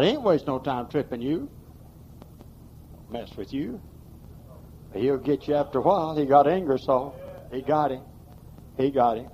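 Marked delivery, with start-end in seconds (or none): none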